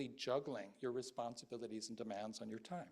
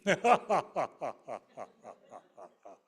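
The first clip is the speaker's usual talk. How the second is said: loud mocking bandit cackle; evil laugh; 14 dB louder than usual. Bandits laugh at the traveler, loud mocking cackle.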